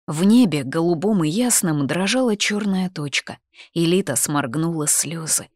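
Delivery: ordinary delivery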